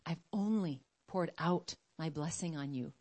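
low, soft and tender reply; hushed divine reassurance; voice of God. Acted. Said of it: "And he's like".

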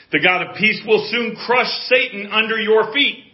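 high, loud preaching of a verse; forceful pulpit declaration; preaching proclamation. Real, not acted. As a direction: proclaim the promise with full voice.

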